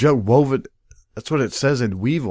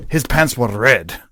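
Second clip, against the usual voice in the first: usual speaking voice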